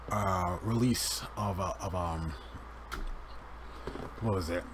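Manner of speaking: talking while chewing gum; words around a wad of gum. Real, not acted. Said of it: "Sorry, I'm chewing gum".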